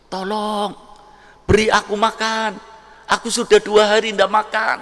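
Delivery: high, pleading voice of a starving beggar; begging beggar. Acted. Starving beggar at the rich couple's door, high pleading. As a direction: plead high and thin for food.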